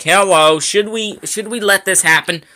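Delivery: mock voice of the lag. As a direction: voice the game lag teasing him. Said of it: "and it's like".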